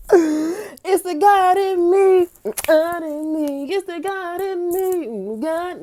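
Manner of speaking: high, slow, tearful voice; overcome, almost crying; choked-up high praise; tearful awe. Real, not acted. Overcome with emotion.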